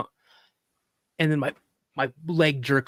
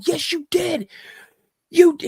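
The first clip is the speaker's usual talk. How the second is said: very high, loud mock protest; insistent arguing; 14 semitones higher, 5 dB louder than usual. Boy contradicts his dad, insistent retold outburst. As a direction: argue back like an upset kid.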